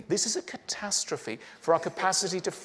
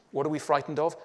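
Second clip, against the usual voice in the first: ordinary talk, nothing marked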